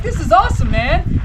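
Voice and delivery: loud, high retold enthusiasm; fired-up. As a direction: declare it with new-found energy. Runner now truly fired up.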